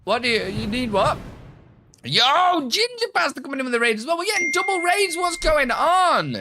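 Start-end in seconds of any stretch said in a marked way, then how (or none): 2.0-6.4 high, hyped-up announcement; high excited yell, stretching the end; excited raid shout-out. Real, not acted. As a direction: burst out over the surprise double raid. Reacts to a second raid arriving.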